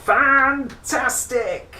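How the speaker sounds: high, slow, loud exclamation; big reveal. Acted. Reader unveiling the name to young listeners, thrilled. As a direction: announce the stick's name like a showman. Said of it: "ready for this?".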